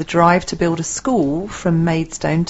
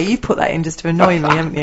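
ordinary talk

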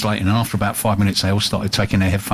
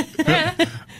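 high burst of laughter. Real, not acted. Laugh after being cut off.

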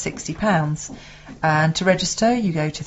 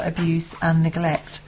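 normal talking voice

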